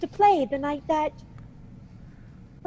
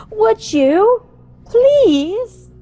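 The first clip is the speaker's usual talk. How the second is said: pleading; 6 semitones higher, 8 dB louder, much slower than usual. Acted. Widow pleading with the inspector.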